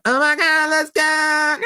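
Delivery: very high-pitched mock squeal; hammed-up cheer, far above normal pitch; excited little kid. Little kid gripping the controller to play Mario, ecstatic. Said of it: "And I'm like".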